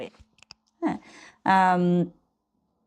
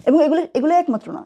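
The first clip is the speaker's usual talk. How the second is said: excited; 7 semitones higher, 4 dB louder, faster than usual. Real, not acted. Showing off her book pile.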